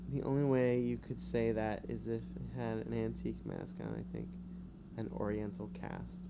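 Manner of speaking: usual speaking voice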